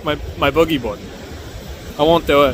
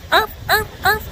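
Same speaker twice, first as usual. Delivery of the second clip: dog barking; 11 semitones higher than usual. Pretend. Pretends to bark like a dog.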